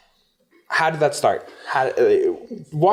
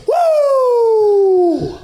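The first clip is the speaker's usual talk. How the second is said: big high whoop to kick off the show; excited whoop; 6 dB louder than usual. Real, not acted. Host hyping up the start of the show, loud whoop. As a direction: cheer the episode in. Hyped podcast intro cheer.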